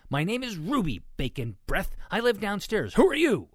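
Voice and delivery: high, loud, building to a challenge; bold demand. A narrator's reading. Ruby introduces herself to huge pigs, bold and rising. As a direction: stand tall and build to the question.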